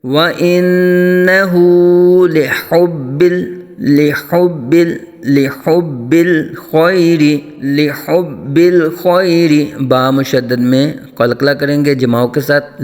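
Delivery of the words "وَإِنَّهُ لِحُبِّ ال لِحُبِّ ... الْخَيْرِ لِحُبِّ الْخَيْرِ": slow melodic tajweed chant; Quran recitation. Shown as a demonstration.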